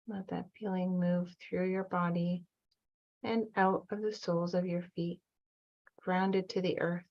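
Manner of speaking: calm, even meditation voice with long pauses; guided meditation. Acted. Guide leading listeners back to their inner child, calm meditation voice. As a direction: guide them gently, leaving room to breathe between phrases.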